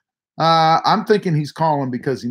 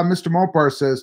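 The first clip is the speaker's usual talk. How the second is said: ordinary talk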